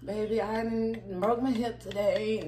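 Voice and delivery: slow frail grandma voice; old lady voice. Retold or imitated. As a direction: talk like a tired old grandma. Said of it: "an old person or old lady".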